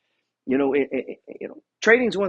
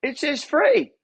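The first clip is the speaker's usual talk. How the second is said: excited claim; 10 semitones higher, 5 dB louder, slower than usual. Retold or imitated. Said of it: "We both said".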